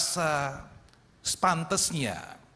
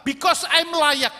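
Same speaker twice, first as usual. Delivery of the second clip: entitled; 7 semitones higher, 6 dB louder, slower than usual. Retold or imitated.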